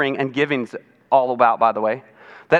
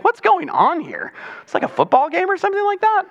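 high, incredulous mock outrage; mock bewilderment; 13 semitones higher than usual. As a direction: pretend to be baffled by the noise. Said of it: "I'm like".

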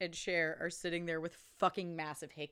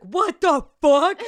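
high, loud, slow laughing outrage; shocked outrage; 7 semitones higher, 16 dB louder, much slower than usual. Retold or imitated. Fiancée dumped at breakfast, shocked high outrage. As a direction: stretch the disbelief out loud. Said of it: "She's like".

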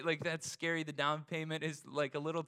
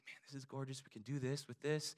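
hushed; 9 dB quieter than usual. Retold or imitated. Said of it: "I'm like".

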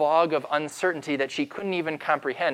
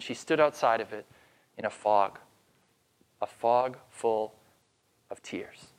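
hushed sorrow; 5 semitones lower than usual. A narrator's reading. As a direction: let it sink to a hushed, grieving whisper. Somber narration of her grief.

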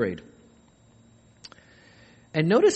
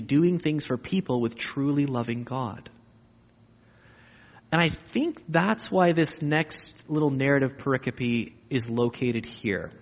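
ordinary talk

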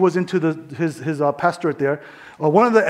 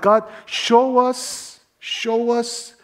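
drawn-out, raised call to God; pleading prayer; 4 semitones higher, much slower than usual. Real, not acted. Preacher asking God to open eyes, drawn-out plea. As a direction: stretch the words out high, imploring.

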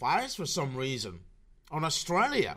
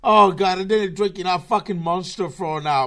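much louder, forced high-energy shout; suddenly loud, hyped-up burst of energy; high energy outburst; 3 semitones higher, 8 dB louder than usual. Shown as a demonstration. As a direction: blast it out like you just chugged an energy drink. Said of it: "trying to put it as most high energy as possible".

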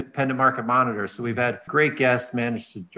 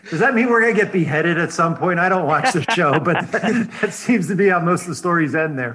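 joking through laughter, raised voice; laughing; 7 semitones higher, 7 dB louder than usual. Real, not acted. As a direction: laugh your way through the joke. Joking about beheading, laughing.

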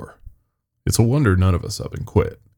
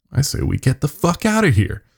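Ernie's voice; 7 semitones higher than usual. Acted. Ernie urges leaving.

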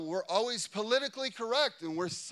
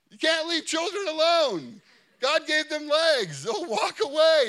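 preaching loudly; 7 semitones higher, 8 dB louder than usual. Real, not acted. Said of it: "preacher driving the point home".

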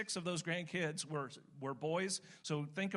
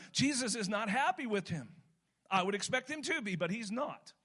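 higher, louder, driving preacher voice; fervent preaching; 5 semitones higher, 4 dB louder than usual. Acted. Pastor pressing his congregation on growing faith, fervent preaching. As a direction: drive the point home from the pulpit.

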